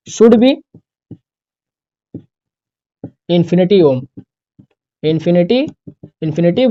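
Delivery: phrase by phrase with long gaps; dictation. Shown as a demonstration. Teacher dictates the rule for students to copy, chunk by chunk. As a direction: dictate each chunk and wait for the pens. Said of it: "वही resistance ही एक तरीके का है".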